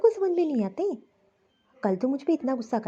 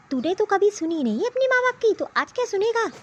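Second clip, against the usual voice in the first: scolding mother; 6 semitones higher than usual. Acted. Mother scolds stubborn son.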